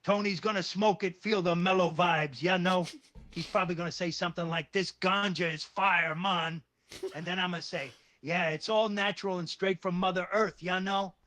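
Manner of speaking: mellow put-on Jamaican Rasta accent; Rastafari patois. Describing Tony's mellow reaction, mock Rasta patois. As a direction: tell it as a laid-back Rasta in patois. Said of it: "with a Rastafari patois".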